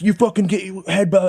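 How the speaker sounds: high mock rant; acted anger. Angry driver to the man who parked, acted anger. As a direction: imitate an angry driver's outburst.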